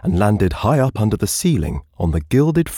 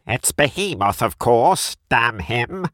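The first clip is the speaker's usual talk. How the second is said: higher, ugly nasal cry; a higher, nasal complaint; 5 semitones higher, slower than usual. Acted. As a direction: whine it out with disgust. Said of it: "Another voice, ugly and nasal, cried".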